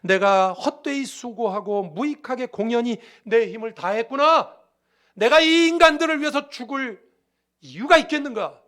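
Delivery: anguished, drawn-out protest building to a shout; despairing lament. Acted. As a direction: act out Jesus's despair at full volume. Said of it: "느끼시는 절망감을 표현한 것입니다".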